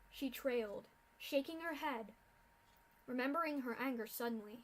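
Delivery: ordinary delivery, nothing marked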